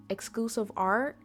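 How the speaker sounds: normal talking voice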